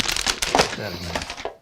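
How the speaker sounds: slow breathy whisper. Shown as a demonstration. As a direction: whisper it slowly, drawn out. Repeats instruction, whispered slow take.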